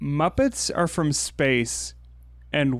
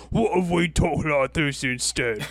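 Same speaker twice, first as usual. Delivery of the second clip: put-on British accent; 3 semitones higher than usual. Imagining Britons deciding to talk differently, mock British accent. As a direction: joke your way into an exaggerated British accent. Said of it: "eventually they were like".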